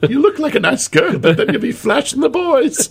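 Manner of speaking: high Irish voice breaking into laughter; laughing Irish quote. Retold or imitated. Storyteller repeats the bartender's line while laughing. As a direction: quote her through giggles. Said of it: "funniest things I've ever seen".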